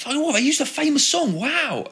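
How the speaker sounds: high-pitched imitated amazement, drawn out; amazed reaction. Viewer hearing a famous song in a skate video, amazed. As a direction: act out a wowed viewer.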